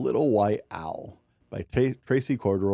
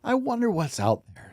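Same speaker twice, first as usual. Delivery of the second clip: owl's wondering line, pitched much higher; little owl voice; 12 semitones higher than usual. Acted. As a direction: lift into a small curious owl.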